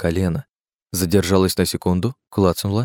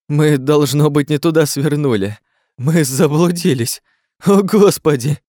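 high, louder, anxious voice building to despair; rising panic; 7 semitones higher, 4 dB louder than usual. Acted. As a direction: panic as if lost in a maze. Jerome panicking about a maze.

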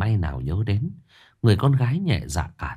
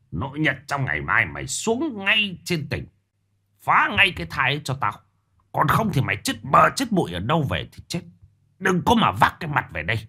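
higher, fast menacing threats to his daughter; cursing through teeth; 6 semitones higher than usual. Acted. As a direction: grit your teeth and threaten her. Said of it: "nghiến răng chửi".

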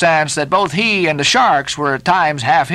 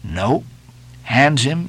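ordinary delivery, nothing marked

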